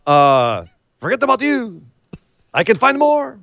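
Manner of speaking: high mock French accent, lightly done. Host voicing Garza in a mock French accent. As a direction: toss it off like a French lover. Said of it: "why he's French all of a sudden".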